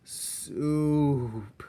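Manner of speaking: long high mournful moan. A narrator's reading. Roscaro moans for soup he can never have. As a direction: let the longing pour out. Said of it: "moaned Roscaro".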